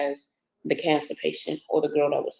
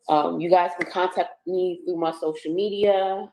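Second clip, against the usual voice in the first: ordinary talk, nothing marked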